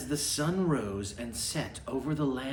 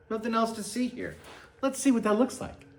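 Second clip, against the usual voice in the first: quick aside; 8 semitones higher, much faster than usual. Real, not acted. Reader aside while showing book.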